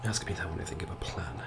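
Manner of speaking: whispered, weary answer; almost a whisper, holding it in; hushed reply. Acted. Commander answers under his breath.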